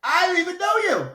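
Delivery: loud, high wail of fake dismay; mock betrayal. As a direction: disown them in hammed-up horror. Playfully disowns co-host over ranking.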